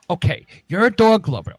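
ordinary delivery

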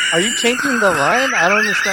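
baffled. Real, not acted. Flustered narrator questions the actress.

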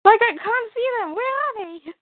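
very high worried whine; mock a fretting hero; whiny Superman. Joining the mock Superman bit.

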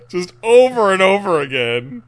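laughing hard while telling it. Real, not acted. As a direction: break up laughing. Laughing at the story.

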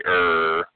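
"er" with the R fully sounded; hard R. Shown as a demonstration. Caller showing the sound others supposedly can't say, hard "er". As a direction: sound out "er" with a clear final R. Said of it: "They don't know how to say".

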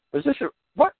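shrill high mock squeal; confused agent. Imitates suspicious agents.

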